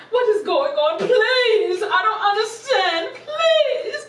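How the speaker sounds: high-pitched, drawn-out theatrical wail; distraught pleading. Acted. Caller exposed by the psychic, high hammed-up pleading. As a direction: beg in rising, overwrought distress.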